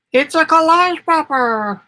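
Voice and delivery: high, loud and slow, proudly naming it; excited announcement. Real, not acted. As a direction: present it like a proud reveal. Announcing her new creation.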